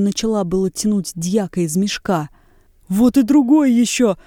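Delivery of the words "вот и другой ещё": loud, high alarmed cry; frightened cry. A narrator's reading. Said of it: "вскрикнул со страхом ткач".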